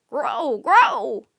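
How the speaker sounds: loud, harsh calling caw; raven croak. Acted. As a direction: croak a command to the flock. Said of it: "Rock croaked".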